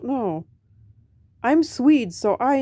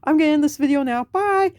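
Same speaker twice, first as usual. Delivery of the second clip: higher, louder playful sorry; cheeky apology to the Vikings, higher and louder; sheepish apology; 7 semitones higher, 4 dB louder than usual. Real, not acted. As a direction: apologize sheepishly and sign off brightly. Apologetic sign-off.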